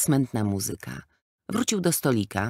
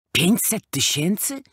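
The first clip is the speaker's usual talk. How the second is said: shocked cry; 8 semitones higher than usual. A narrator's reading. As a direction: cry out pięćset tysięcy in disbelief. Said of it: "krzyknął wentyl".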